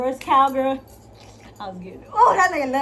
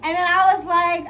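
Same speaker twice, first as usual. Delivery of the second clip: usual speaking voice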